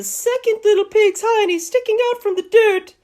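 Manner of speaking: rising into a very high squeaky voice; comic squeal. Acted. Wolf showing evidence in the dirt, comic high voice. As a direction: climb up high and stay there. Pointing out the pig's behind.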